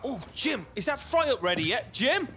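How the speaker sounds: calling out. Acted. Calling to Jim in kitchen.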